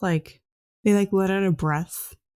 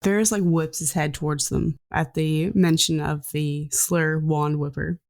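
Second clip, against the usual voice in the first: usual speaking voice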